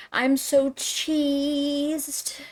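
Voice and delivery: high, very drawn-out parody; mimicking the lyric. Mocking [name]'s slang lyric, drawn-out imitation.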